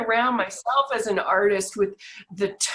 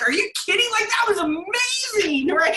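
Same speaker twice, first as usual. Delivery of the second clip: excited disbelief; 8 semitones higher, 3 dB louder than usual. Retold or imitated. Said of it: "And I'm like".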